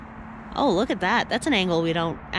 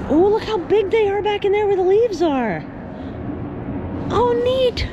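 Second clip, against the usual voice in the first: raised, excited voice over noise; yelling over helicopter; 7 semitones higher than usual. Real, not acted. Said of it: "Sorry about the helicopter".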